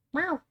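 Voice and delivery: very high, soft meow; cat meow. Pretend. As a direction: squeak out a tiny kitten meow. Random cat noise.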